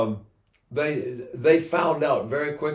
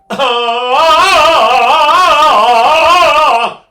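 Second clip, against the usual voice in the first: put-on diaphragm baby cry; 12 semitones higher, 13 dB louder than usual. Shown as a demonstration. Teacher shows the diaphragmatic cry, long loud and high. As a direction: sustain a loud belly-driven cry. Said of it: "like the babies cry".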